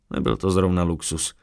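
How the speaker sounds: ordinary delivery, nothing marked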